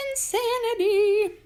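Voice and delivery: high, slow, sung "insanity"; sing-song. Real, not acted. Exasperated drawn-out word.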